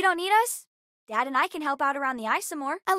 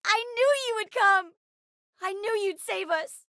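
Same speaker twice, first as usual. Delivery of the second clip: high, thrilled cry to a rescuer; excited, voice pitched way up; relieved excitement; 8 semitones higher than usual. Acted. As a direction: burst out with joyful relief. Greeting a rescuer.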